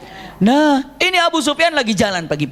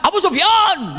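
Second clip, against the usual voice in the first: panicked shout; 10 semitones higher, slower than usual. Retold or imitated. Abbas runs after Abu Sufyan shouting, high panicked call. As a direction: shout his name in a panic. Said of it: "dengan teriakan".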